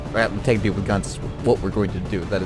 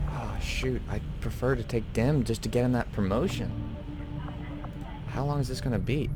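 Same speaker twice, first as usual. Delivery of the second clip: ordinary delivery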